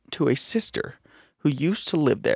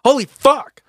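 mock startled shout; 10 semitones higher, 6 dB louder than usual. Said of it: "Are you both ready now?".

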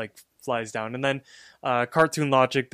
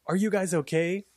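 higher-pitched girls' question, acted; imitated concerned girls; 6 semitones higher than usual. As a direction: ask it high, mimicking worried onlookers. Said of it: "and they're like".

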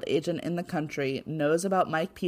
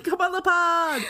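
squealed high invitation; louder and higher, a playful squeal; excited high echo; 11 semitones higher, 4 dB louder than usual. Acted. Echoing, podcast invitation.

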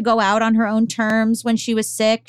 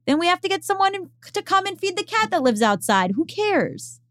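exasperated retort; 10 semitones higher than usual. Retold or imitated. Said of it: "And I was like".

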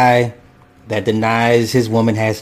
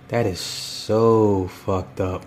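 disgusted; 4 semitones lower, 6 dB quieter, much slower than usual. Real, not acted. Disgusted at the clip.

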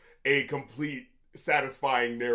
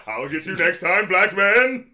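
cartoon villain; 2 semitones higher, 6 dB louder than usual.

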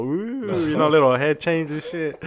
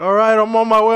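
shouted, raised reply; calling back loud; 7 semitones higher, 10 dB louder than usual. Retold or imitated. Answering parents honking outside, shouted reply. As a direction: yell down to the parents. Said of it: "I was like".

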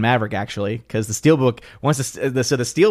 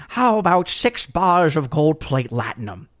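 Quark impression; 4 semitones higher, slower than usual. Quark-style quote, every episode.

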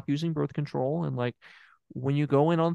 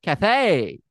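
Kathy said louder and higher with a falling end; emphatic name; 4 semitones higher, 6 dB louder than usual. Shown as a demonstration. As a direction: hit Kathy hard and drop it.

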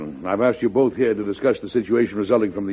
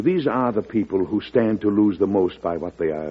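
ordinary talk, nothing marked

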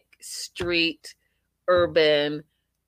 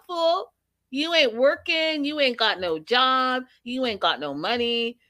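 sassy scolding; 6 semitones higher than usual. Retold or imitated. Scolding an unemployed boyfriend.

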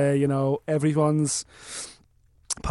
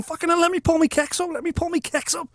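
panicked plea; 11 semitones higher, 4 dB quieter than usual. Retold or imitated. Dragged out mid-wee by cousins.

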